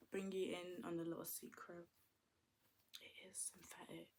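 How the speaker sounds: secret whisper. Acted.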